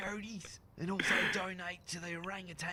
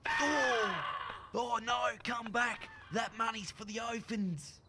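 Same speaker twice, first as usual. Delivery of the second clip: slow desperate yell; calling after the money; shouting; 4 semitones higher, 5 dB louder, slower than usual. Acted. Money flying away.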